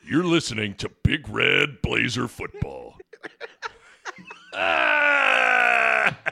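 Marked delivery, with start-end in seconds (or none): 0.0-2.9 radio station ID. Pretend.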